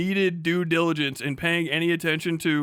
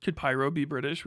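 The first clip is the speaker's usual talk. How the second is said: usual speaking voice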